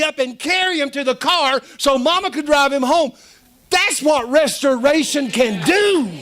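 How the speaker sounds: high-pitched testimony at full force; impassioned preaching. Real, not acted. Preacher testifying to church how his daddy was restored, impassioned.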